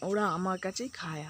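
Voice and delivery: ordinary talk, nothing marked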